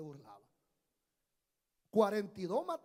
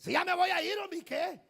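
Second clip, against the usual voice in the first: loud, higher-pitched burst of defiance; defiant retort; 9 semitones higher, 9 dB louder than usual. Acted. Pastor answering gossip about his trip, defiant and loud. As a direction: fire it back, exasperated and loud. Retorting to people asking if he leaves.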